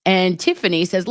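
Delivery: ordinary talk, nothing marked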